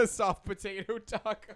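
slow, laughing through the order. Real, not acted. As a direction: giggle it out, slowly. Laughing echo of friend's order.

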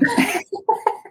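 bright burst of real laughter. Real, not acted. Mom laughing at her son's remark, real laugh. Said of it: "It was so funny".

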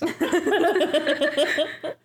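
squealing laugh; high laughter. Real, not acted. Group laughing.

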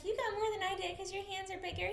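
very high mock girly impression; exaggerated high-pitched imitation; Kendra voice. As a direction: squeak it out like a giggly girl. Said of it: "And then she's like".

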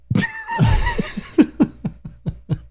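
high shouted laugh. Real, not acted. Big laugh.

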